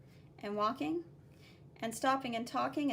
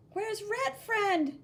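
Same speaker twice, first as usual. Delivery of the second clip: higher, louder, slow call; worried calling; 9 semitones higher, 5 dB louder, much slower than usual. Acted. Blue kid searching for his friend, loud calling.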